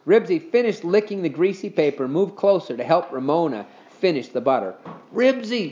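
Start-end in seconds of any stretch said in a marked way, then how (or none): 5.1-5.7 a higher, read-aloud yell of the dog's name; mild yell. A narrator's reading. Henry yells at his dog, mild mock yell. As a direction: call the dog sharply, lightly voiced. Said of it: "yelled Henry".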